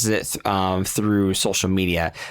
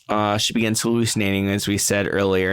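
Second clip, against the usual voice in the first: ordinary delivery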